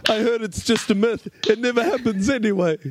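high-pitched defensive patter; mock-panicked excuse. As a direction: wave off the warning in a high voice. Poe brushes off warning, fleeing.

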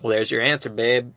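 low-pitched imitation of the boy; low mimicry of his voice; imitating his talk. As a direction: drop the pitch for a smooth reply. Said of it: "that's how he talks like this".

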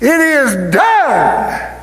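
long drawn-out triumphant cry; slow, high proclamation from on high; acted shout. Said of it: "he shouts".

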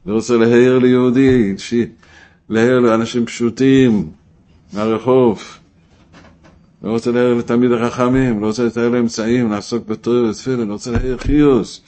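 loud, insistent rallying cry; full-voiced rousing sermon; impassioned preaching. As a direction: proclaim each line like a mission. Preaches in the first person, impassioned.

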